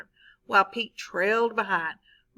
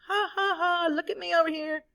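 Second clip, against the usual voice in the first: high mock sing-song teasing; teacher voicing Pete taunting the kids, high; 7 semitones higher than usual. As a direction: tease them from across the field. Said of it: "taunting them".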